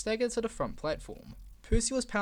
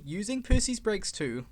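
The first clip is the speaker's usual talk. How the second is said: usual speaking voice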